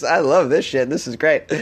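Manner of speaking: ordinary delivery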